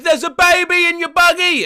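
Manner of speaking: high, loud shout from a car; passing shout. Retold or imitated. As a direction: yell it out the window.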